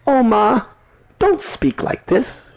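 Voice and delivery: put-on high, loud, exuberant boyish voice; excited Madhu Mangal. Madhu Mangal cheers to Yashoda, high and loud. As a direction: burst out joyfully, high and loud. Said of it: "he said".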